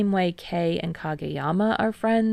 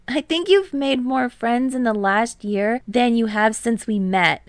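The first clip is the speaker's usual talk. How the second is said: lightly higher friend voice; Tadashi's voice; 5 semitones higher, 4 dB louder than usual. A narrator's reading. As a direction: lift it a little for Tadashi.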